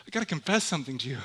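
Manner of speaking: usual speaking voice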